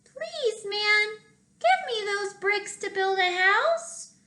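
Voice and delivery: high, polite little pig. Acted. Third pig asks the man for bricks, high and polite. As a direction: ask sweetly like a small pig.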